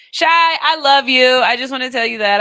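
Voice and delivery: loud, high fan declaration; gushing shout. Pretend. Fan calling to singer [name], gushing loudly. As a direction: call out adoringly to a star.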